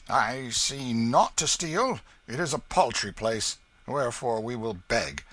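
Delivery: lightly voiced rogue, emphatic and drawn out; Hugo proposing a scheme. A narrator's reading. Hugo to the King after finding nothing to steal, lightly scheming. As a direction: propose it firmly as a scheming vagabond.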